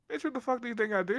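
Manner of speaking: high, indignant snap; mock outraged comeback; incredulous retort. Retorting to girls.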